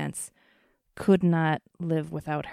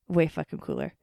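ordinary talk, nothing marked

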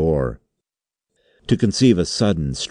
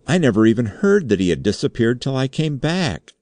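high, anxious denial; frightened protest; 8 semitones higher than usual. A narrator's reading. Undersecretary defending himself to the chairman, frightened. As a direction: protest desperately in a raised pitch. Terrified suspect protesting his innocence.

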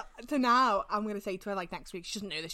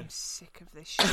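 imitating tired dad; 7 semitones lower, 7 dB quieter than usual. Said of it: "my dad was just like".

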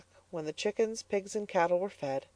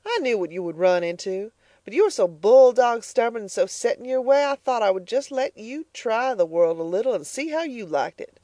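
louder, scornful; scolding mother; 6 semitones higher, 4 dB louder than usual. Acted. Mrs Comstock scolding Elnora, louder. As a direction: scold her stubborn daughter.

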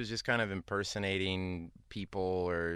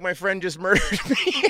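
laughing; 10 semitones higher, 9 dB louder than usual. Retold or imitated. Retelling victim's words, laughing.

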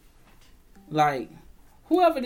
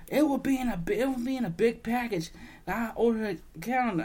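higher mock customer voice; mimicking customer; 4 semitones higher, faster than usual. Imitating the customer describing her package, mocking. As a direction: parody the demanding customer. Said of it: "she said".

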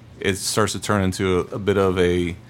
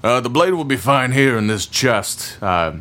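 higher, louder burly smith; gruff blacksmith voice; 4 semitones higher, 5 dB louder than usual. Acted. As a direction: boom it out like a proud smith. Smith answering the player.

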